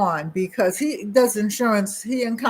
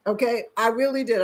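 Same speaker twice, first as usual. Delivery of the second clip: ordinary talk, nothing marked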